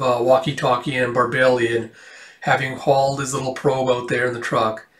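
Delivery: ordinary delivery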